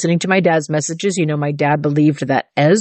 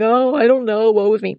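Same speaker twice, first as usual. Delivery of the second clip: put-on woe-is-me whine; hammed-up, pitiful self-pity; mock meowing; 4 semitones higher than usual. Preacher mocking a cowering, self-pitying believer, mock meowing. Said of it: "not meowing".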